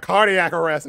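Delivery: loud, high shouted power call; mock attack call. Ring bearer zaps Captain Planet, mock battle cry. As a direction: yell it like casting a cartoon spell. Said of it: "I pointed it at Captain Planet".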